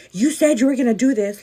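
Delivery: loud, hammed-up motherly accusation; mock accusing mom. Vlogger mimicking moms who recall old promises, mock accusing. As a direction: imitate a mom holding you to your word, loudly. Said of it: "Like, our moms".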